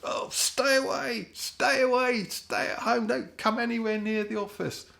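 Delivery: very high, loud mock panic; hammed-up boss begging you to stay home; panicky boss. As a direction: shriek it like a terrified boss. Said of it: "the boss would go".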